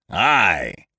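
a gruff roared aye. A narrator's reading. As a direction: bellow it back at them. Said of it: "roared back Bose".

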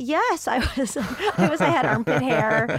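laughing through an embarrassed confession; sheepish laugh while admitting it. Real, not acted. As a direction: giggle it out, owning it. Admitting she was the smelly girl.

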